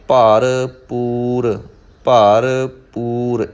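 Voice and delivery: ਭਰਪੂਰ very slow, each time as "paar, poor"; split into pairs. Shown as a demonstration. Teacher drills it for learners, ਭਰਪੂਰ slowly in two parts. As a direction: separate the halves of ਭਰਪੂਰ with a clear p. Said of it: "ਇਸ ਤਰ੍ਹਾਂ ਪਾਠ ਕਰਾਂਗੇ".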